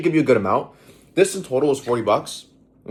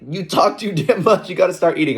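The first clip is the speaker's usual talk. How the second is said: mocking commenter; 8 semitones higher, 4 dB louder than usual. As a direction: read the comment in a squeaky mock voice. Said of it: "they were like".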